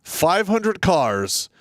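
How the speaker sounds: Five hundred cars, hundred drawn out high; stress on hundred. Real, not acted. Emphatic slow drawn-out stress.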